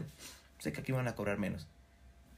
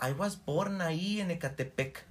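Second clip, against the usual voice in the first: mock tourist; 7 semitones higher, slower than usual.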